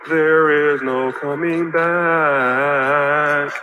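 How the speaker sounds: very slow, low, stretched words; trailer-style slow repeat; drawn-out dramatic. Acted. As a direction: stretch every word like a movie trailer.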